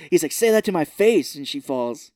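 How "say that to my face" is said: loud, higher tough-guy challenge; mock taunt. Host voicing Gnome King taunting Dorothy, loud and cocky. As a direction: dare her, loud and cocky.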